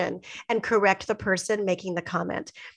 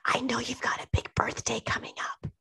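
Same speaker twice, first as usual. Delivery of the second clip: hushed secretive whisper; imitated whisper; 10 dB quieter than usual. Retelling people whispering about her birthday, hushed whisper. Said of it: "people were whispering to me".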